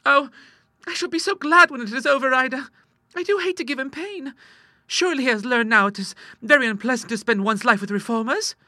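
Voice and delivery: put-on very high, fretful girlish voice; reluctant sister Clara. Young woman regretting her father's pain, high plaintive voice. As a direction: plead plaintively in high pitch.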